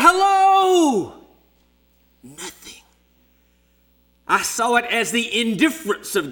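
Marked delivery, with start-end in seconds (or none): 0.0-1.2 high, loud shout through the trees; running through the forest and shouting for the kids; yelling. Retold or imitated. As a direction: call out with everything you have. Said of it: "I ran and yelled".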